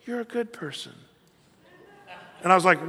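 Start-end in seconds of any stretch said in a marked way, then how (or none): 0.0-0.9 very soft, higher coaxing voice; soft tempter. Acted. Satan flattering his target, softly coaxing. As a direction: quietly sweet-talk the listener. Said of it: "Satan sounds like this".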